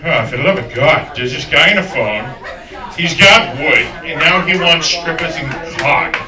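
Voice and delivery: a manager grumbling into the store intercom; lightly voiced boss on the speaker system; mock PA announcer. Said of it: "and all he said was".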